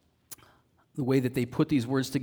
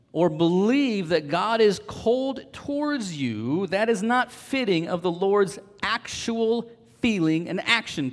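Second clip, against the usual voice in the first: slow, emphatic sermon appeal, pitched up; earnest preaching; 4 semitones higher, slower than usual. Real, not acted. Preacher assuring believers they are God's own, slow and emphatic. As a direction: press each phrase home with long pauses. Preacher addresses the congregation directly.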